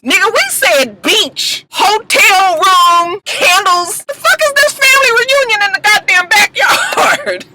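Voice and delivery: high, loud exasperated outburst; outraged. Real, not acted. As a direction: rant in disbelief, slowing on the list. Outraged at the backyard picnic.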